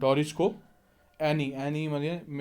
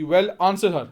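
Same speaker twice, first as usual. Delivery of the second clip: fierce, much louder and higher command; fiercely; 4 semitones higher, 9 dB louder, much slower than usual. Acted. Mother fiercely orders her daughter to answer, much louder and higher. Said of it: "Mrs Pearson fiercely".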